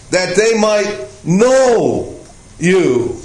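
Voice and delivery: "know" drawn out, then a pause before "you"; slow down and set "know" apart; stress on KNOW. Shown as a demonstration. Preacher explains the emphasized word in Jesus' prayer, stressing "know". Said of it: "this word is being emphasized".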